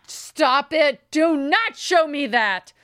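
punchy, pleading mock outrage; retold yell. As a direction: yell at the movie, re-enacted. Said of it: "I yelled at the mo".

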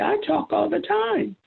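high-pitched, indignant "I talk all the time"; a mother insisting her son is wrong; indignant protest. Retold or imitated.

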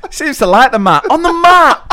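mock shout. Acted voice with high shout.

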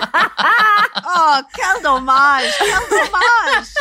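loud French quip through laughter; laughing mock pity. Listener reacting to the teacher story, laughing mock pity. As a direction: raise the voice in teasing French sympathy.

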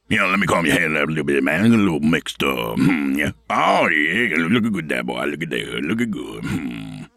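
a hint of a mumbling backwoods hermit; lightly voiced odd loner, rambling. Odd yurt dweller talks.

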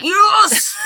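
high, excited acted yelp; delighted mock cheer. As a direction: exaggerate a gleeful, high-pitched yes. Retells delighted reaction to misread word.

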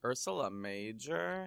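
slow, hushed disbelief; incredulous. Real, not acted. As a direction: repeat it in quiet disbelief. Incredulous echo.

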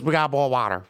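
imitated Memphis waitress accent on "boil water"; thick Memphis accent. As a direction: say "boil" as "bawl", Memphis style. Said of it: "she had a very thick accent".

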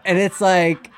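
ordinary delivery

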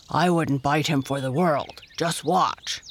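put-on bear character voice; exclaiming bear. A narrator's reading. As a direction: speak up as an earnest storybook bear. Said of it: "exclaimed Dido".

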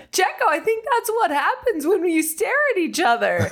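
high, laughing, teasing remark; giggly high voice teasing the driver; high amused quip. Retold or imitated. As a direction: tease him, pitch way up and amused. Retold joke in high voice.

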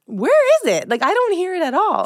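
high-pitched baffled protest; incredulous. Retold or imitated. Host retells reacting to accent comment, incredulous. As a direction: question it in high, exaggerated disbelief.

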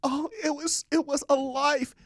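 mock a squeamish survivor in hammed-up grief; mock distraught. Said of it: "they go".

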